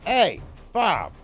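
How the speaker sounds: yelling at Bob for hitting his dog; angry shout. Real, not acted. NPC attacks his dog.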